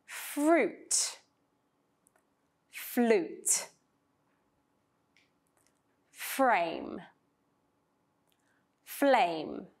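"Fruit" with fr, "flute" with fl, each word said slowly; minimal pairs. Shown as a demonstration. Teacher contrasting minimal pairs for students to repeat. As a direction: set each "fr" word against its "fl" word. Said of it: "Notice the different consonant blends".

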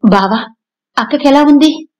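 anxious rush to know about her sister; flustered. A narrator's reading. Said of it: "కంగారుగా అడిగింది".

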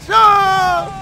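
long, high shout of a name; calling out. Pretend. Player calling to his lost son in the game, calling out. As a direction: holler it out, stretching the vowel.

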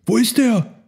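high, anxious elephant voice; searching question. A narrator's reading. Herd searches for missing Elmar, high questioning voice. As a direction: ask out high for the missing friend.

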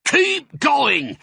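very high, loud holler; bellowed urging, pitched high; shouted. A narrator's reading. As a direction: shout orders across to the tractor drivers. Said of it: "the fat Boggis shouted".

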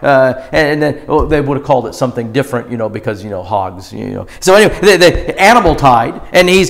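ordinary talk

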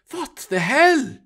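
stunned question, pitched high; mild shock. Acted. Eric finds the dead crew.

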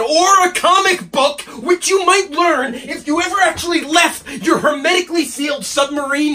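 high, exasperated burst; angry. Acted. Assistant mocking his boss's sealed studio life, angry. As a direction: flare up sarcastically at him. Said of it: "he's quite angry".

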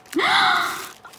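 long, high gasp of delight; sharp intake at something adorable; big delighted gasp; astonished gasp. Real, not acted. Gasp on seeing the pens.

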